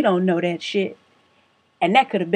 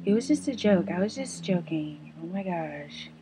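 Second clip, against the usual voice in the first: meek imitated excuse; 8 dB quieter than usual. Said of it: "she goes".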